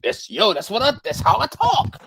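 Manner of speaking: high-pitched, hammed-up pleading defense; exasperated protest. Philly worker defending his yo to coworkers, exasperated high-pitched protest. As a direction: protest your innocence, voice pushed way up.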